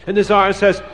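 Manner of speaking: ordinary delivery, nothing marked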